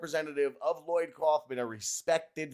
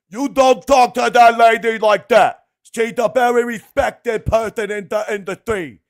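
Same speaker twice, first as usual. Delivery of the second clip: mock tough guy; 9 semitones higher, 16 dB louder than usual. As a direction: bellow it like an offended muscleman. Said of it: "he's like".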